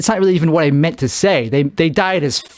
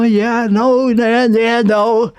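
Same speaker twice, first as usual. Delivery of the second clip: higher-pitched run of yeah-no; protesting denial; 6 semitones higher, much slower than usual. Acted. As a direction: rattle off a defensive yeah, no, held high. Reply to being asked if insane.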